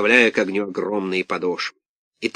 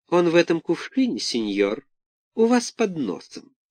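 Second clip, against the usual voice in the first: high, sly fox voice; Alice the Fox; 7 semitones higher than usual. A narrator's reading. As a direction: slyly point him out. Fox points out Buratino.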